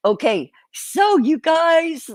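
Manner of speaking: drawn out, high and loud kickoff; big show opener. Real, not acted. Host kicking off the stream for viewers, drawn-out excited call. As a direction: launch the livestream with a big slow hello.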